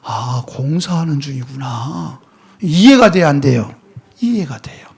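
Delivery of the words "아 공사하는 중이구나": slow, low, soft dawning understanding; acted "oh, I see", murmured to oneself; quiet realization. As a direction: let it sink in, hushed and drawn out. Driver realizing the road is under repair.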